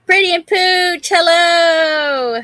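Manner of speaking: high, loud call-out to friends; excited greeting. Real, not acted. Host calling hello to chat regulars, excited and high.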